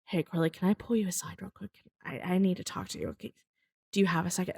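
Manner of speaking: hushed fast sheepish request to pull her aside. Retold or imitated. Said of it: "sheepishly like".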